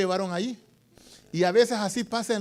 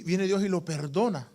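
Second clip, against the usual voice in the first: ordinary delivery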